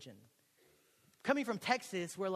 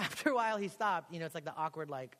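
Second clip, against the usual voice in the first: normal talking voice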